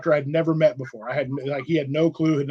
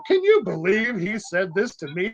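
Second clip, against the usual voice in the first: offended; 4 semitones higher than usual. Retold or imitated. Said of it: "just offended at the highest level".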